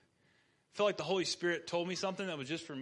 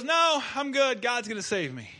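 stubborn refusal; 5 semitones higher, 7 dB louder than usual. Acted. Joke: stubborn flood man refuses.

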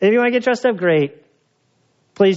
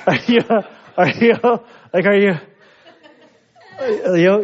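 halting, louder mock concern; awkward concern; 3 semitones higher, 3 dB louder than usual. Man unsure how to comfort a crying woman, awkward. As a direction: stammer the question in short, raised starts.

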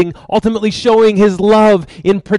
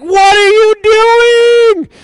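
high, loud outraged king; indignant outburst, voice pitched way up; freaking out; 11 semitones higher, 4 dB louder than usual. Acted. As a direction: act out a furious, incredulous husband. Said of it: "and he freaks out".

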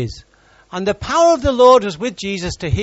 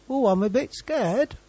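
mock mild fright; 4 semitones higher, 8 dB quieter than usual. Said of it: "Not just a little bit".

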